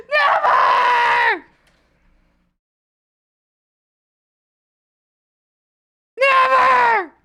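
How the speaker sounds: high, loud, crying-out mock rage; over-the-top screamed refusal; mock furious shout. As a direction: scream it like a tantrum at the boss. Mock outburst at boss.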